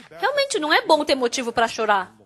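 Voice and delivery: normal talking voice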